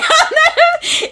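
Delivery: high loud laugh; laughing fit. Real, not acted. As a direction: keep cracking up. Big laugh.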